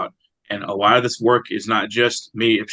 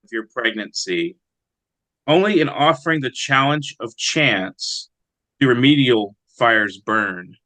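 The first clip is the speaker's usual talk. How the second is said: slow, lifted, deliberate poetry recital; poem reading; 3 semitones higher, 3 dB louder than usual. Acted. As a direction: read each line as a weighty image, unhurried. Poet reads his own poem.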